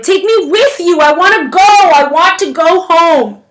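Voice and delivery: loud, high, pleading yell; shouting. A narrator's reading. Boy screams to go home as the train leaves. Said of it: "he shouted".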